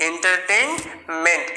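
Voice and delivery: এন্টারটেইনমেন্ট said slowly, falling at the end; final teacher model of এন্টারটেইনমেন্ট; slow whole-word demo. Shown as a demonstration. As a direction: blend the parts into এন্টারটেইনমেন্ট slowly. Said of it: "মানে বিনোদন".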